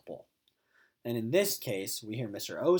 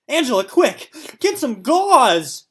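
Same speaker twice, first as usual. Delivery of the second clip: high, loud, slow frantic yell; 11 semitones higher, 13 dB louder, slower than usual. Acted. Mister O yelling to his wife for gauze, hammed-up frantic yell. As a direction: shout for help in a panic. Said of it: "Mister O yelled".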